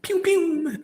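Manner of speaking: squeaky high laser "pew, pew"; pew pew sound. Real, not acted. Vlogger making a playful laser sound between chat topics. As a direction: fire off a silly laser noise.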